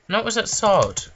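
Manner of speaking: ordinary talk, nothing marked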